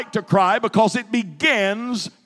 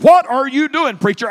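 aggravated snap; 6 semitones higher, 6 dB louder than usual. Retold or imitated. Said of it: "He looked at me so aggravated".

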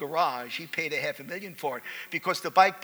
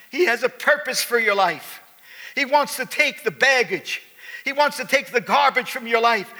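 pleading invitation over music; earnest sermon invitation; altar call; 5 semitones higher, 5 dB louder than usual. Acted. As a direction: draw them to the altar.

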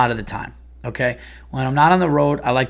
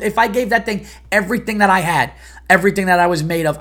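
ordinary talk

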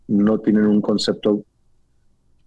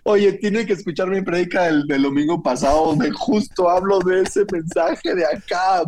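laughing; 6 semitones higher than usual. Real, not acted. Joking about bad sermon.

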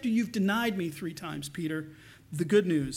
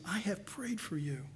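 softer, breathy hushed promise; whisper; 6 dB quieter than usual. Acted. Preacher voicing Jesus' promise to Peter, whispered. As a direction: whisper it like a secret vow.

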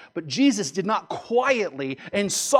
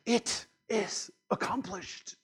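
hushed, slow, solemn actor's delivery; quiet, dramatic; 3 semitones higher, 7 dB quieter, slower than usual. Retold or imitated. Preacher imitating an actor's Jesus on the cross, quiet and dramatic. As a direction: imitate a film death line, soft and drawn out. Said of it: "quietly and dramatically going".

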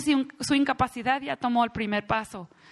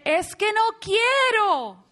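put-on pitched-up child complaining; whiny child; 7 semitones higher than usual. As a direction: whine it like a stubborn kid. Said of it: "cuando el niño dice".

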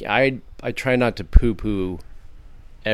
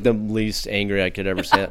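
ordinary talk

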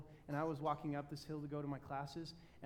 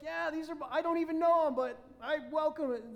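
cheerful excuse; 11 semitones higher, 10 dB louder than usual. Retold or imitated. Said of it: "I'd be like".

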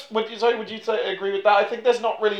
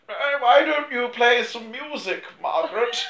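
higher, rising mock royal invitation; lightly voiced royals; 7 semitones higher than usual. King and Queen invite Margaret to play, mock royal. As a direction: invite her grandly, pitch soaring at the name.

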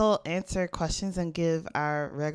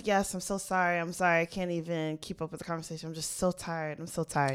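tired driver; 3 semitones lower, 8 dB quieter than usual. Retold or imitated. Tired Uber driver quoted.

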